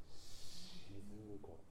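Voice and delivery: 沈む頃 very slow and soft, cushioned; 沈む頃 sung slow, soft and smooth; smooth diction. Shown as a demonstration. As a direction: glide through 沈む頃 smoothly and quietly. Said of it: "なんか滑らかな発音をなさってみてください".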